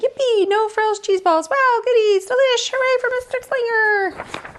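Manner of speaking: put-on high, gleeful kids' cheers; cheering kids. The pupils cheer for Mister Slinger, high and gleeful. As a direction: cheer like excited children.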